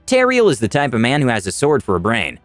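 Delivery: plain reading voice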